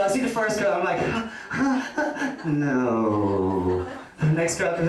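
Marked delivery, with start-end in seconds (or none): 1.1-2.4 mock laugh. Stand-up acting out reaction. 2.4-3.9 low, dismissive no; a curt deadpan no; flat deadpan no. Acted. Acted rejection of first girl.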